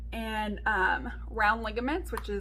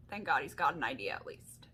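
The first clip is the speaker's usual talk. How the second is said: relieved whisper; 10 semitones lower, 6 dB quieter than usual. Retold or imitated. Relieved thought while waiting.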